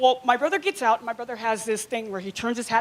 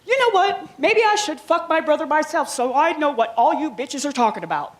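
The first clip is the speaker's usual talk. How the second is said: loud, higher exasperated outburst; fed-up snap; 4 semitones higher, 5 dB louder than usual. Retold or imitated. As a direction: let her have it, fed up. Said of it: "I turn around and I look at her".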